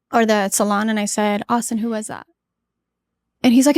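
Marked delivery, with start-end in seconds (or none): 1.5-2.3 calm question. Retold or imitated. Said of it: "I was calm, very calm".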